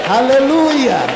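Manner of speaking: loud, high Hallelujah call; rousing shout to the congregation; preacher's call. Real, not acted. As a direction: call it out to the church.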